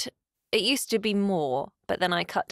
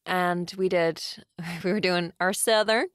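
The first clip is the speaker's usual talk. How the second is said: chatty General American accent. Shown as a demonstration. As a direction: tell it casually with an American accent. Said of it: "this is general American".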